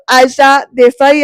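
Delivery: shocked repeat of the mother's name; gulping in shock. Retold or imitated. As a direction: swallow hard and repeat the name in disbelief. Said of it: "Aundha गिळत म्हणतो".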